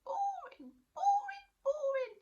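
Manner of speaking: very high, loud singsong; tiny monster. Acted. Tiny monster scorning chores to Natalie, high singsong. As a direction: whine it, tiny and mocking.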